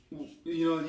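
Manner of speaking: ordinary talk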